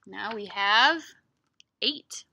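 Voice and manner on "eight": usual speaking voice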